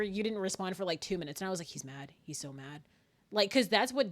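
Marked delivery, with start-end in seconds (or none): 1.7-2.8 low, very soft fretting; hushed, dropped-pitch worry; worried inner voice. Retold or imitated. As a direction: say it under your breath, lower. Retold worried thought about opponent.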